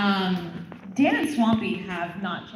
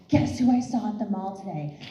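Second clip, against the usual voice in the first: freaking out; 4 semitones higher than usual. Retold or imitated. Doctor retelling her daughter's mall sighting, freaking out. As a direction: mimic a starstruck teen's excitement. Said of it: "my daughter was freaking out".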